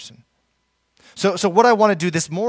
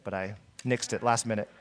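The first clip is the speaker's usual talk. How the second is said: ordinary talk, nothing marked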